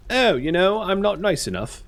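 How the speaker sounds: high-pitched, badly faked Cockney; terrible Cockney accent. Burial chamber suddenly complaining, in terrible Cockney. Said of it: "it was a terrible Cockney accent".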